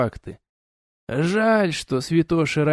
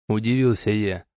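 ordinary delivery, nothing marked

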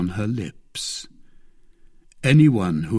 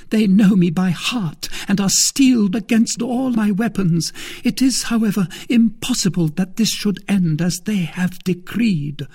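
higher, louder anguished outburst; milady in despair; 9 semitones higher, 4 dB louder than usual. Acted. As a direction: pour out her desperation to the empty room.

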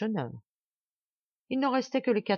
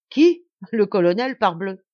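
exclaiming; 3 semitones higher, 9 dB louder than usual. A narrator's reading. Sergeant telling Martial who sent him, exclaiming. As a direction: throw the question back loudly.

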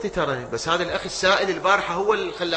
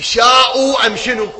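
preaching shout; 5 semitones higher, 7 dB louder than usual. Real, not acted. Preaching at full force.

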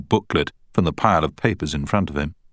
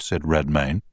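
plain reading voice

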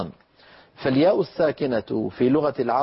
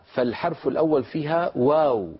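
normal talking voice

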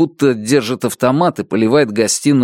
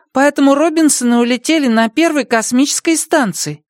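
put-on child voice. Boy asks.

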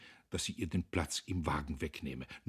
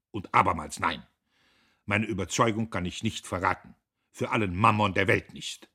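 shrill refusal; 10 dB louder than usual. A narrator's reading.

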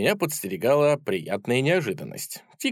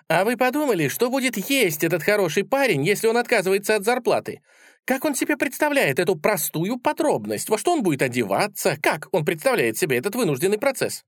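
high, pestering character voice; practical sceptic; 9 semitones higher than usual. A narrator's reading. Little Tik challenges the idealists' praise, high pestering voice. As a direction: pile up the questions, pitched high.

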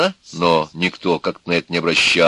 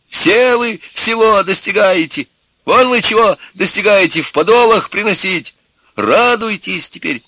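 shouting father; 12 semitones higher, 5 dB louder than usual. A narrator's reading. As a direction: berate them at full voice. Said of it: "закричал на него отец". (гневный крик с упрёком)

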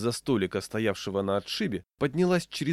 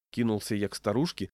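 plain reading voice

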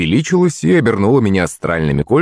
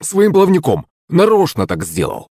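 high-pitched whining servant voice; high, indignant character voice; aggrieved complaint; 10 semitones higher than usual. Acted. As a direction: protest like a wronged tattletale, pitch way up. Servant complaining about his opponent.

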